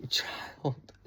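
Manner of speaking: whispered, disbelieving reaction; hushed one-word reaction; whispered aside. Retold or imitated. Mock reaction to judges' instructions.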